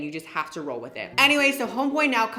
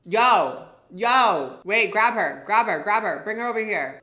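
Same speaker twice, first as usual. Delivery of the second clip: loud mock call from a car window; imitating a yell; 3 semitones higher, 6 dB louder, slower than usual. Rapper calls from his car to the security guard, mock shout. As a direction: bark it out like the rapper calling for them. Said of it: "he's yelling for us".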